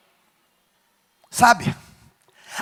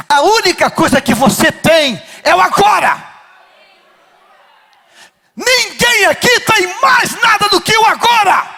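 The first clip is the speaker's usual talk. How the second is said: preaching shout; 8 semitones higher than usual. Acted. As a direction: hammer the truth home to the church. Preacher repeating with force.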